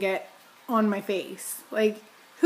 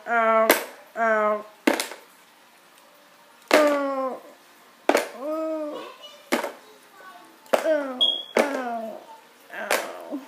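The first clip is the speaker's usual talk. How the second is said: repeated pained ows; 2 semitones higher, much slower than usual. Real, not acted. Pulling clothespins off face.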